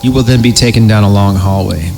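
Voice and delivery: ordinary delivery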